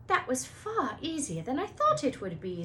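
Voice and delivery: lightly voiced bright schoolgirl; a hint of clever schoolgirl; Hermione voice.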